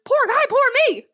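very high, quick comic squeal; mock indignant protest. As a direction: protest for sympathy in mock outrage. Mock protest at listener's sympathy.

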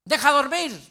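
loud, high, exasperated shout; retold angry holler; yell. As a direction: shout after someone to let you sleep. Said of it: "le grité".

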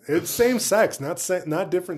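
high, loud joking protest. Real, not acted. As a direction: blurt it out loud. Joking exclaim.

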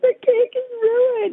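high, slow, crying wail; sobbing. Retold or imitated. To her parents at the door, sobbing over the cake. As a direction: wail it like the whole day is over. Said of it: "And I was, like, bawling".

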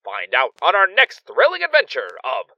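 high, loud cliffhanger announcer; radio serial announcer. A narrator's reading. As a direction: sell the thrilling next episode. Radio serial narrator wraps up episode.

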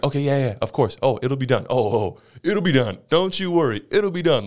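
mock cocky supervisor reassuring the team; super confident. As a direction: brush off worry with easy confidence. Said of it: "Like, super confident".

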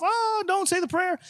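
high, drawn-out imitated wail, held back in volume; mock scream. Lady in wheelchair protesting a street prayer, mock screaming. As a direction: mimic a screamer in a high, stretched voice. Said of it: "she starts screaming too".

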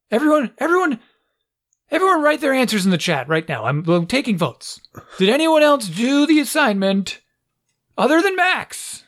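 very high-pitched, insistent teacher; exasperated teacher impression. Teacher asking who else did the assignment early, exasperated. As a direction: imitate a frazzled teacher polling the class. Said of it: "this is how she sounds".

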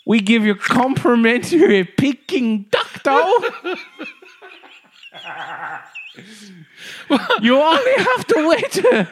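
very high, slow, loud comic voice through laughter; high shopkeeper caricature. Acted. Shopkeeper apologising to customer for the drone delay, very high voice. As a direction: squeal the apology, cracking up.

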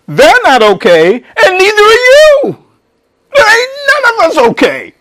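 high, loud, voice straining and cracking; full-force pulpit cry; impassioned preaching. Acted. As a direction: hammer home that nobody is okay.

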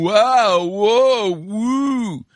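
loud, high-pitched, drawn-out crowd cheer imitation; mock amazement. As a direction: whoop it in exaggerated awe, mocking the crowd. Mimicking an impressed crowd.

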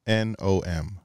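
letter by letter, each one clear; spelling it out. Real, not acted. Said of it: "Can you use it in a sentence?".

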